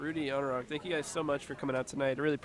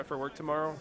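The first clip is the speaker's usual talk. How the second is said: ordinary delivery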